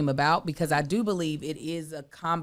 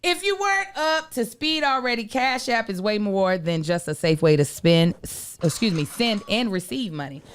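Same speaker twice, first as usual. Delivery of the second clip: upbeat sponsor read with a chatty aside; podcast host selling the Cash App card, upbeat ad voice; 6 dB louder than usual. Acted. Reads a sponsor ad.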